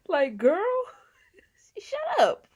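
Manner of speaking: high, loud squeal breaking into laughter; laughing mock scolding. Host telling the wife to shut up, laughing exasperation. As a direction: tell her off while cracking up.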